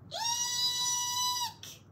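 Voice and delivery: piercing, very high shriek of fright; shrieking scream. A narrator's reading. Missus Potter sees the piglet, a piercing shriek. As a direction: scream at the sight of the snout. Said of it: "screamed Missus Potter".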